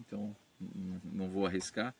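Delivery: usual speaking voice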